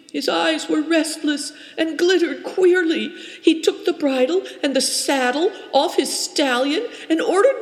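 high, tense, breathless telling; a frightened girl blurting out what happened; upset young girl. A narrator's reading. Turakina's long upset account.

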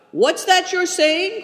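high, loud, slow startled question; surprised cry. A narrator's reading. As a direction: cry out in alarm. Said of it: "cried Good Fortune".

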